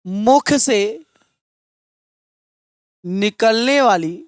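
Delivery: word-by-word classroom dictation with long pauses; measured, broken-up teacher's dictation. Real, not acted. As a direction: dictate in short phrases, leaving time to write. Dictates a definition for writing down.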